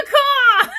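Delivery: high laughing caw-caw; goat call. Pretend. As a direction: squawk caw-caw like a bird. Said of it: "sort of a goat call".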